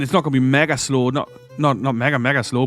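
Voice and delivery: usual speaking voice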